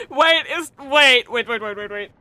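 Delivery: normal talking voice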